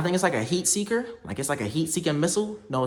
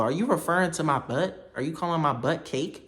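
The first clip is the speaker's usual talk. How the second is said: ordinary delivery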